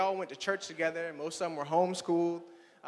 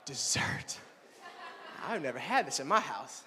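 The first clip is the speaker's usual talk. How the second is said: awed whisper; 4 semitones lower than usual. Retold or imitated. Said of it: "I'm like".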